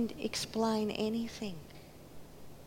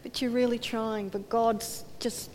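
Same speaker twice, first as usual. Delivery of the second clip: usual speaking voice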